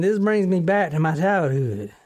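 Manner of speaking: put-on country accent, held through an apology. Host excusing the bit to co-hosts, put-on country accent. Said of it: "I'm talking in this accent".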